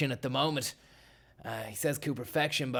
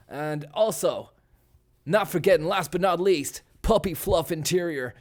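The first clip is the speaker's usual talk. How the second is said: hammed-up race-day ad read, pushed louder on the product pitch; mock sponsor read; 2 semitones higher, 5 dB louder than usual. Host plugs absurd sponsor shoes, hammed-up ad read. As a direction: sell the shoes like a motorsport advert.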